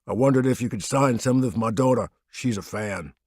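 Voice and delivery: a hint of a deep humble rumble. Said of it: "His voice rumbled and resonated".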